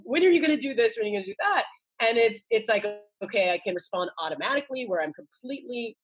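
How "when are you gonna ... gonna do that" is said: high, laughing nagging mom voice; playful impression of a nagging mother, pitched high; imitating nagging mom. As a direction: tease like a pestering mother, half laughing. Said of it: "and I'm like".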